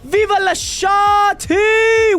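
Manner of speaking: hyped shout-out. Real, not acted. Hyped greeting to a viewer.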